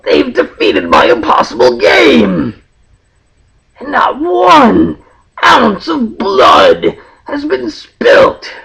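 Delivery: slow, loud ranting villain. Acted. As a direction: rage at the heroes, dragging it out.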